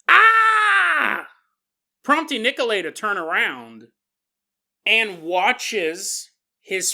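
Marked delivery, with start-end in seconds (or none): 0.1-1.3 high, loud "ah" scream. Acted. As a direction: let out a long, sudden high-pitched scream. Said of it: "lets out a loud scream".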